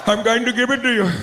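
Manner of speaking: high mock East Indian accent. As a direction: talk dirty in a put-on Indian accent. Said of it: "that East Indian accent".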